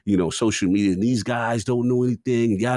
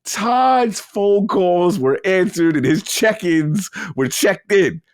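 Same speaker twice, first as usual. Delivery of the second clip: mocking drawl; 10 semitones higher, 4 dB louder, slower than usual. Mocking studio hierarchy, drawn out.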